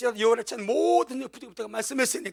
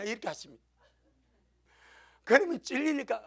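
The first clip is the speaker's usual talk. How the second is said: usual speaking voice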